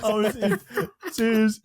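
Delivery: high, helpless laughter through the words; laughing hard. Real, not acted. Co-host recalling the cringe intro, real laughing fit. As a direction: struggle to get it out for laughing.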